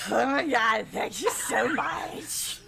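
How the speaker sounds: loud, delighted outburst; overjoyed, almost shouting; gushing thanks. Acted. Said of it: "one of them turns and says".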